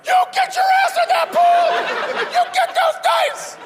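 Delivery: shrieking mock rage way up high; a grown man so mad his voice jumps octaves; furious voice cracking high. Angry dealer orders the gambler into the pool, shrieking high. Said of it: "his voice goes up a couple octaves".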